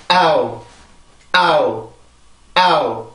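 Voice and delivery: 아우 in one glide, stress on 아, falling; one-syllable diphthong. Shown as a demonstration. Teacher demonstrating owl for students, one stressed falling syllable. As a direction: hit 아 once and slide down into 우. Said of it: "성대가 한 번만 긴장을 해주면 돼요".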